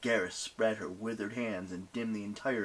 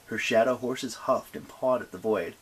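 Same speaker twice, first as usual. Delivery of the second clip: ordinary delivery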